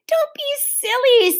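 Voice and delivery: high, drawn-out exasperated dismissal; impatient. A narrator's reading. Beezus snubs her little sister, impatient. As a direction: brush off a silly idea impatiently. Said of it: "said Beezus impatiently".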